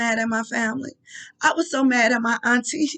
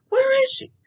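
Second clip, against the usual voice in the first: anguished cry; 14 semitones higher than usual. Retold or imitated. Wondering where her dead mom was.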